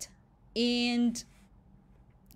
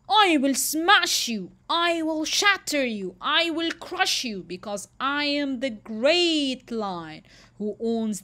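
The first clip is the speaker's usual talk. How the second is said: louder, higher furious threats; enraged lion bellowing down the well; furious roar; 5 semitones higher, 6 dB louder than usual. Acted. Lion roars at his reflection.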